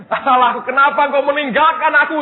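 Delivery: loud, high imitated cry; imitated shout. Preacher proves Jesus' words were clear, loud high shout. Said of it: "Dia teriak".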